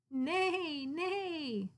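a cat pretending to be a unicorn; kitty neigh. Kitty neighs at her friends, high pretend neigh.

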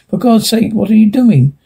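high, horrified plea; alarmed cry. A narrator's reading. Husband horrified at his wife creeping on the floor. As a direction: recoil in horror as you ask. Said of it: "he cried".

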